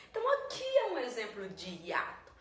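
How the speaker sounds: usual speaking voice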